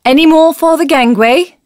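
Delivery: high, loud call across the deck; shouted call. A narrator's reading. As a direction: cry it out over the crowd. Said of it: "a voice like a cry shouted".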